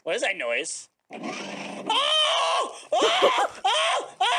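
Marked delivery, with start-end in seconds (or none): 1.1-4.4 startled yelps. Acted. Startled by noise, cobwebs appear.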